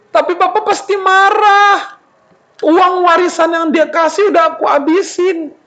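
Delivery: high, plaintive acted worry; distressed whine. Son dreads papa's anger over spent inheritance, whining. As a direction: fret in a wailing whine.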